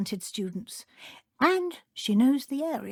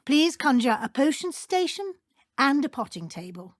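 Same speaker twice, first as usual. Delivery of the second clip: usual speaking voice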